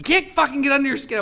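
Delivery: high-pitched exasperated outburst; mock-angry complaint; imitated anger. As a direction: mimic someone mad and frustrated. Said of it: "had people get mad".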